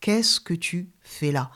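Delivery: slow, hushed near-whisper on the question; gentle voice. Retold or imitated. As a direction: whisper it tenderly, without condemnation or stress. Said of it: "Il m'a parlé avec une voix douce".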